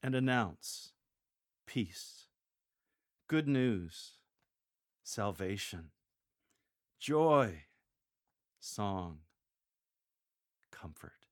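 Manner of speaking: each word set apart by long pauses; announced with pauses. Shown as a demonstration. Lector showing the congregation each promise, each word set off by a pause. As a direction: deliver "peace", "good news", "salvation", "joy", "song", "comfort" one by one, pausing before each. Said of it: "Picture those in your congregation that are downhearted".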